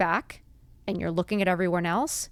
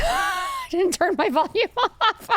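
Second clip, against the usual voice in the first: high-pitched laugh through the words, slower; 14 semitones higher, slower than usual. Real, not acted. Caught off guard by her phone, laughing. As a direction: giggle it out, embarrassed.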